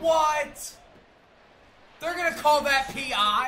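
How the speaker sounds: loud high outraged shout; panicking gamer. Real, not acted. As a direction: yell in disbelief at the screen.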